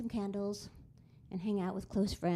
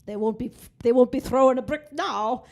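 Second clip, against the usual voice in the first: much louder, higher booming cop voice; giant Irish policeman; 5 semitones higher, 11 dB louder than usual. Retold or imitated. Giant policeman guards the window for the pianist, loud assurance. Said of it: "this giant policeman stood in front of the window".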